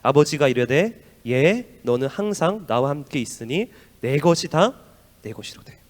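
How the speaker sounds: slow, loud, measured reading of the verse; preacher leads a congregation reading the verse; scripture reading. Acted. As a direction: read the father's words aloud together slowly. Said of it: "시작".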